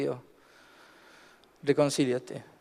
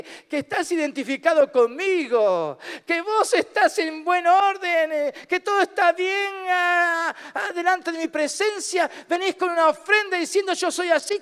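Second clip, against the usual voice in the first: confronting voice; 6 semitones higher than usual. Acted. Jesus voiced confronting the offerer.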